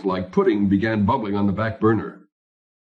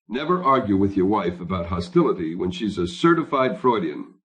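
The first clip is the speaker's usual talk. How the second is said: usual speaking voice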